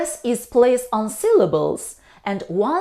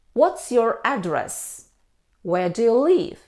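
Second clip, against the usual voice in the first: usual speaking voice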